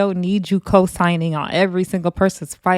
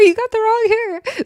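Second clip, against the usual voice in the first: even higher, squealed repeat of "wrong hair"; shrill repeat yell. Retold or imitated. Cast screaming again at Erica Raven, shriller yell. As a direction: shriek it again, more frantic. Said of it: "They're all yelling".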